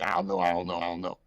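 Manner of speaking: mock his vague shrug; lightly voiced dopey friend; imitating a clueless friend. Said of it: "And you was like".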